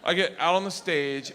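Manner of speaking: normal talking voice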